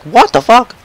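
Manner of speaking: high-pitched mock disbelief; imitated shocked reaction. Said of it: "I was like".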